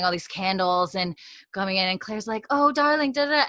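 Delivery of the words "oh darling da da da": higher, louder, gushing wife voice; Claire gushing. Retold or imitated. Host playing Claire greeting Frank, higher and gushing. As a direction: greet your husband sweetly and brightly.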